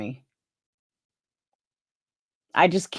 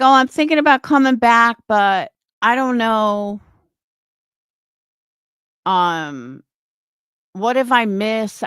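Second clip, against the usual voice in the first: mock airhead; 3 semitones higher, 4 dB louder than usual. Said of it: "It was like an airhead".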